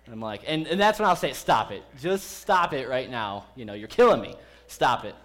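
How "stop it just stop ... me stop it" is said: joking. Retold or imitated. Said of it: "it's a joking kind of phrase".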